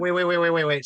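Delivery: ordinary talk, nothing marked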